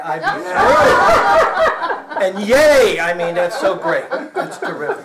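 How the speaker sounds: squealing high and loud with laughter, x0.4 at first; hammed-up joy. Comic telling his audience about his rooms, in mock delight. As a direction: gush with mock delight over the painting.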